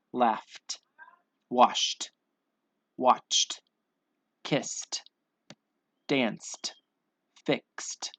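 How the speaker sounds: each word one syllable with a breathed t; deliberate word list, ed said as t; final T sound. Shown as a demonstration. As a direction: pop an airy t at the end of each. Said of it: "all ending in the T sound".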